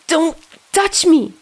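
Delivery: high, slow, panicked cry; angry retort. Acted. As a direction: throw it back in panic. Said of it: "Dazai retaliated".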